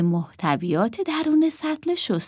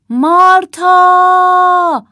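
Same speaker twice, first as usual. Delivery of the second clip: long, high, very loud scream of a name; 11 semitones higher, 12 dB louder, much slower than usual. A narrator's reading. Emma screams for her sister, full-voiced. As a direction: shriek it, drawing the name right out. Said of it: "جیغ کشید".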